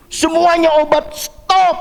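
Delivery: high, slow, emphatic retold command; imitated urgent order. Doctor halting all the patient's medicine, imitated urgent command.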